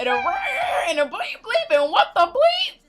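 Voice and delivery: high, loud bleeped rant; mock cussing outburst. Demonstrating how she cusses, high hammed-up shout. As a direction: burst out shouting the censor bleeps.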